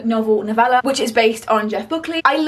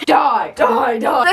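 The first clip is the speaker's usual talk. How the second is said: playful shout; 4 dB louder, much slower than usual. Pretend. Acting out characters dying.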